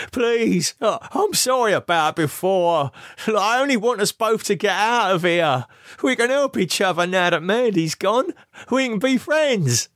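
high, desperate begging voice; pleading. A narrator's reading. Lee injured and begging Camilla, high desperate plea. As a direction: beg for help through the pain.

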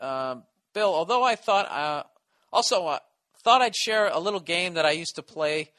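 normal talking voice

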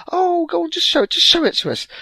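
very high, loud wheedling; pestering grandparent. Retold or imitated. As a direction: nag to see the bag.

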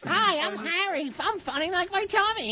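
lightly voiced kids' TV host, high and chirpy; a hint of a kids' show host. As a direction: pitch it like a cheery children's show mascot. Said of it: "kid show tagline".

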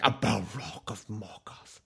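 slow, rasping wizard line; gravelly wizard voice. Retold or imitated. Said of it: "But he's like".

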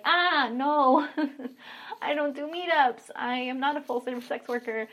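retold high-pitched, embarrassed turndown; awkward refusal. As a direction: nervously laugh off the proposition.

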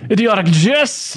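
slow, high theatrical declaiming; hammy stage actor. Acted. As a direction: proclaim it like a Shakespearean actor. Hamming up the hate mail line.

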